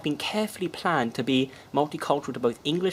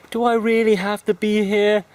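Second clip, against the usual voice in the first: high, loud, complaining whine; a reluctant partygoer whining at his friend; whiny guy; 9 semitones higher, 8 dB louder than usual. Retold or imitated. A guy in the toilet whines to his friend, high and loud. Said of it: "one of them goes".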